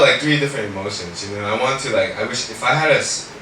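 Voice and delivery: ordinary delivery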